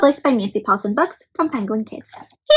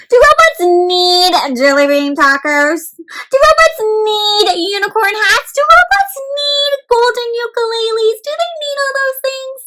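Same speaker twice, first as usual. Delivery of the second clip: building silly questions; 9 semitones higher, 3 dB louder than usual. Shown as a demonstration.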